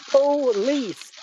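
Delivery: "Police" drawn out as two separate syllables; over-enunciate "Police" syllable by syllable for a listener; slow, split syllables. Retold or imitated. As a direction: say "Police" slowly, PO... LEECE, with a break between. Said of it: "Say it again slowly".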